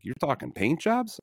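high, slow disbelieving question; high-pitched mock disbelief; incredulous. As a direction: act stunned he'd even ask. Incredulous retort to old neighbor.

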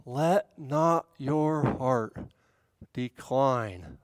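downward motion. Shown as a demonstration. Preacher shows the heart's downward motion, sinking on "decline". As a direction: let each word of "Let not your heart decline" step slowly down. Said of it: "this is a downward motion".

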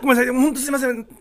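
high, hurried re-enacted apology; flustered apology. Retold frantic apology on set. (取り乱して早口で謝る)

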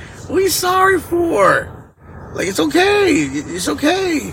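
high, slow imitated soothing; consoling dad. Dad to his crying son after losing, imitated consoling. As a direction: draw it out high, placating a child.

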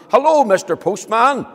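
high, loud call; a friendly shout up the path; shouting to the postman. Acted. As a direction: call out to someone far off. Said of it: "he shouted to the postman".

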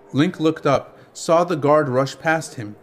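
ordinary delivery

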